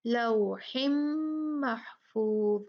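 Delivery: slow लौहिम महफूज with a held nasal m; Quranic recitation demo. Shown as a demonstration. Tajweed teacher shows the rule, लौहिम recited with a long hummed m. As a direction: hum the joined m of लौहिम long before महफूज. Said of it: "यहाँ पर भी देखें".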